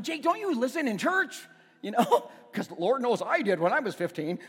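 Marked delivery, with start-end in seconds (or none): 0.0-1.4 put-on high, exasperated scold of a teenage son; mock-exasperated dad; angry scolding. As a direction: snap at him like a fed-up dad. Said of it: "getting mad".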